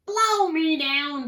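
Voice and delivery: loud, high, drawn-out outburst; pirate bellow. A narrator's reading. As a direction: bellow it slowly in pirate outrage. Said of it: "bellowed Pete".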